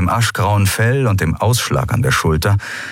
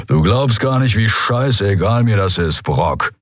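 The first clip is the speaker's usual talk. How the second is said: grumble; 2 semitones higher than usual. Acted. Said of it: "brummte sein Skria-Kumpan".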